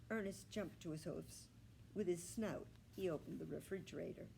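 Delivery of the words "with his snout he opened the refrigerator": usual speaking voice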